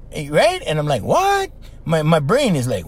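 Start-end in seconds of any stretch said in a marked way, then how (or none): 1.0-1.5 high, squeaky disbelieving what; a jolt of pure disbelief; stunned what. Retold or imitated. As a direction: yelp it out in shock. Stunned at seeing TV star.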